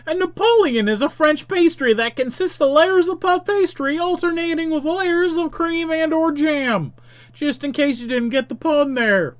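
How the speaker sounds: high-pitched know-it-all aside; Monstrous Manuel. Acted. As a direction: explain it in a high put-on voice. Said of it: "And Monstrous Manuel tells me".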